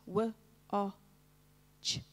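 "w, o, ch" as isolated sounds, wuh, short o, ch; sounded out. Shown as a demonstration. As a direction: segment "w, o, ch" with a gap after each sound. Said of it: "write the sound and say it".

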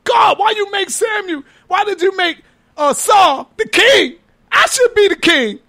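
high-pitched imitation of a sobbing complaint; mock crying plea. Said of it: "Sobbing and crying".

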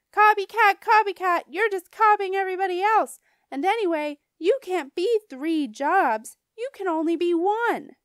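loud, high schoolyard jeer; mean taunting. A narrator's reading. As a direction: taunt like a bratty kid. Mean Jim taunts the narrator.

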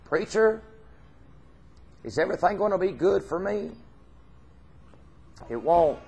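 normal talking voice

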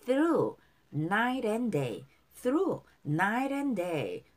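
very slow, stretched, sustained words; repeat-after-me model. Shown as a demonstration. Teacher leads repetition of the lyrics, slow and drawn out. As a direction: hold each word long for learners to echo. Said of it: "자, 따라할게요".

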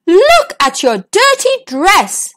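a father aghast at the mess; horrified king. A narrator's reading. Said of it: "he threw his hands up in horror".

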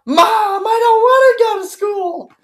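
put-on high, slow, crying child's whine; whiny kid. Mocking a kid begging mom to skip school. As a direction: whimper like a kid begging to stay home. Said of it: "You're probably one of those kids".

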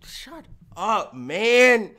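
ordinary delivery

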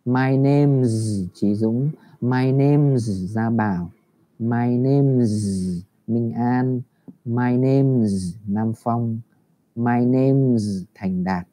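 slow, each name's ending in a clear z. Shown as a demonstration. Teacher drills name's with z for the class, slow. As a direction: model the z sound again and again. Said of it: "Khi mình giới thiệu tên của mình".

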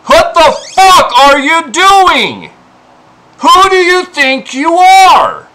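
loud, slow, furious confrontation; real anger at the trolls; furious outburst. Real, not acted. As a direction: shout it at them. Confronting harassers directly.

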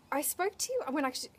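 ordinary talk, nothing marked

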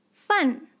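饭 in a falling fourth tone. Shown as a demonstration. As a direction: drop the pitch sharply through 饭. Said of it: "fourth tone".

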